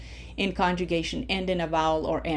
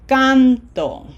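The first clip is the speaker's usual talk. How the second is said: CANto, first syllable higher and louder; stress on first syllable; 4 semitones higher, 8 dB louder than usual. Shown as a demonstration. Teacher models canto for learners, stress on CAN. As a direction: punch the CAN in canto, let "to" drop. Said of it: "Can is emphasized".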